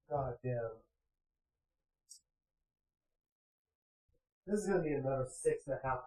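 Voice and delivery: quiet dejected mumbling at the long stream ahead; muttered to himself. Real, not acted. Streamer dejected, muttering near mic.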